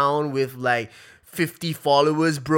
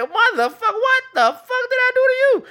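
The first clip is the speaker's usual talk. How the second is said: squealing in shrill disbelief, far higher; mock outraged high-pitched cry; high falsetto protest at being attacked; shocked high squeal; 9 dB louder than usual. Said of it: "their intentions, like".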